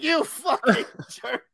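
mock outrage. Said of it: "I'm like".

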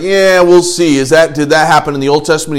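acted-out dubious retort; skeptical. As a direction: challenge it like an unconvinced crowd.

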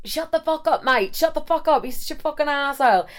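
high, hammed-up English accent; mock-irate English lad voice; English lad snapping. English guy silencing the laughing roommate, irate put-on English accent. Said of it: "the guy I'm with is literally like".

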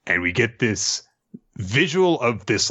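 usual speaking voice

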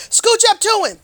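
rising eager call, Scooch up to him; excited urging. Shown as a demonstration. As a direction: push the word Scooch up high and loud. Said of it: "Get real close".

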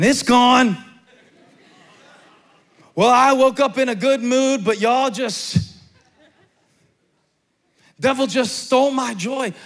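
mock the person blaming the devil for a lost mood; mocking complainer. Preacher mocks a believer blaming the devil, hammed-up whine.

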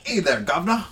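high hammed-up cockney hello; a cheeky put-on Englishman; mock English accent. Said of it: "we'll just do our English accents".

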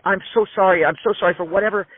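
high, frantic mock apology; imitating a scared record exec begging forgiveness; imitating terrified apology. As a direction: grovel in a panicked rush, voice up high. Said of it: "he looks terrified".

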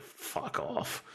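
dismissive retort. Retold or imitated. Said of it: "Is he gonna say, like".

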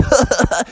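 high, put-on snicker; mocking laugh. Employee laughing at little Junior, mocking cackle. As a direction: laugh at him teasingly.

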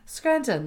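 soft "Scranton" with a sounded T; affected pronunciation. Retold or imitated. Recalling the way Kelly said it, affected "Scranton". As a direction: echo "Scranton" with dainty care. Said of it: "The way she said".

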